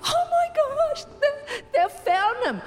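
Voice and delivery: very high, mock-tearful outburst; blubbering relief. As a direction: act out tearful relief that the child is found. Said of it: "I'm still a blubbering mess".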